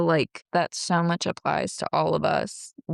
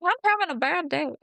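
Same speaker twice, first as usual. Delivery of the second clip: much higher, fast and squeaky, playfully voicing Mary; mock high voice; 12 semitones higher, faster than usual. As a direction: toss it off in a silly high voice, hurried. Mocking Mary's reply.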